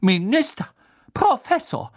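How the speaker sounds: high, loud, outraged address; indignant Madame Pomfrey. A narrator's reading. Nurse confronts minister and professor, indignant. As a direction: call both men to order. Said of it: "sagte Madame Pomfrey empört".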